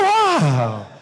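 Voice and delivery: a loud, low burst of amazement; surprised wow. Retold or imitated. Pastor retelling his surprise at the paint shop, loud wow. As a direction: let the surprise pop out. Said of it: "I said".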